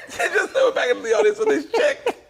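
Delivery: high, loud, laughing through the words. Real, not acted. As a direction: crack up mid-sentence. Cracking up telling story.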